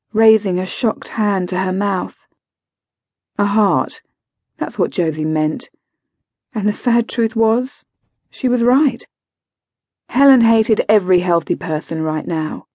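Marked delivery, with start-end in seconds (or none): none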